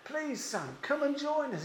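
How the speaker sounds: soft, high, gentle plea to his son; pleading father. A narrator's reading. Father gently pleading with his angry older son to come in. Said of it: "Pleads with him".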